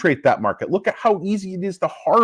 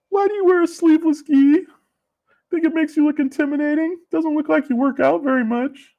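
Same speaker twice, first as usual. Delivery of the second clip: high mocking taunt; 11 semitones higher than usual. Mocking questions at the sensei.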